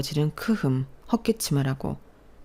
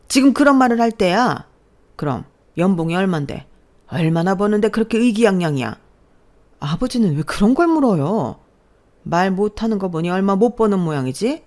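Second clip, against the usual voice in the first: loud, higher contemptuous grilling; scornful shout; 5 semitones higher, 7 dB louder than usual. Acted. Father grilling boyfriend about salary, scornful shout. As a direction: sneer and demand.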